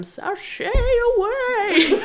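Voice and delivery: high drag queen sashay; campy drawn-out drag catchphrase; Ben de la Creme. Retold or imitated. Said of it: "modeling it off of Ben de la Creme".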